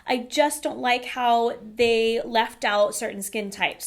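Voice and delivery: normal talking voice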